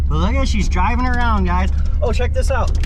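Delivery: on a bumpy road. Real, not acted. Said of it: "sorry for the bumps".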